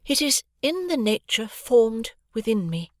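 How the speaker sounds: emphatic and deliberate; stress on the words. A narrator's reading. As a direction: lean slowly on every word. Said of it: "added with a stress upon the words".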